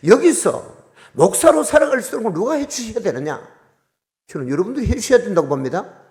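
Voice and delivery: high, loud preaching appeal; fervent appeal. Real, not acted. Pastor appealing to the congregation for support, fervent. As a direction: call on the congregation directly.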